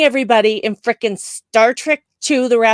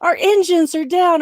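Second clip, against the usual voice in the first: high mock distress message; distressed ship's caller; 10 semitones higher, 4 dB louder than usual. Fan half-remembering ship's message, high urgent call. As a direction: report trouble in a pitched-up imitation. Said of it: "or whatever that message is".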